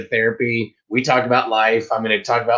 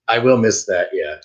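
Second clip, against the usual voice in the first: usual speaking voice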